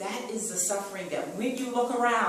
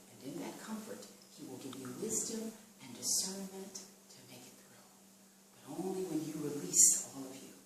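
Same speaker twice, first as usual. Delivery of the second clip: much softer, hushed comforting voice; soft prayerful close; 5 semitones lower, 9 dB quieter than usual. Acted. Preacher comforts the suffering, softly and tenderly. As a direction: ease them down gently into prayer.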